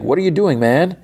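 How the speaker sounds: high retold protest; alarmed. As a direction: imitate a shocked friend confronting him. Friend confronts Irving during attack.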